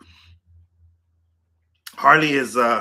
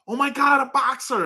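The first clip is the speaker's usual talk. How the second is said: much higher, gushing over the dog; excited gush; 12 semitones higher than usual. Retold or imitated. Imitating strangers gushing over her boxer. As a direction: squeal it like a stranger spotting a boxer.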